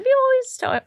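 suddenly squeaky and high. Pretend. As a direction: jump up into a squeak. Squeaky high voice mid-sentence.

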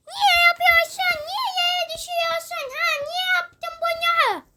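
high whining complaint; lightly voiced upset kid; whiny child voice. Child whines at friend for dropping it.

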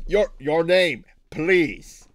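insist, losing patience; exasperated repeat. Acted.

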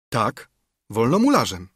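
assured answer with a falling end; settled, affirmative reply; confirming. A narrator's reading. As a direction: confirm it simply and firmly. Narrator's firm answer to the doubt.

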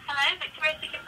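usual speaking voice

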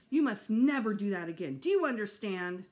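loud, emphatic command; stern scolding. A narrator's reading. Mother forbidding son to repeat it, stern scolding. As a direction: lay down the law.

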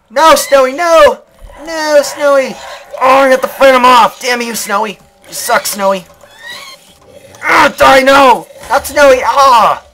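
high, loud frantic cries; frantic shouting at the pet wolf; panicked yelling. Real, not acted. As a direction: scream at the dog to stop. Pet wolf fights creepers.

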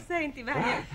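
normal talking voice

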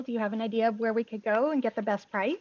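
normal talking voice

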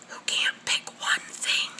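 soft unvoiced whisper. Real, not acted. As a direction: drop to a whisper.

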